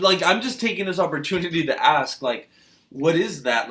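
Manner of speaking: ordinary talk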